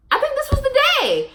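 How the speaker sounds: high, piercing mock squeak; sudden comic squeal; squeaky high voice. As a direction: pitch it way up like a cartoon. Joking squeaky aside to viewers.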